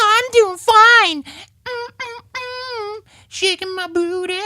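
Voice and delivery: squeaky high-pitched puppet chatter, slow and drawn out. Acted. Hand puppet greeting Dan in a squeaky falsetto.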